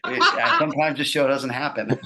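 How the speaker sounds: laughing through the punchline, voice jumping up. Real, not acted. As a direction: giggle the words out.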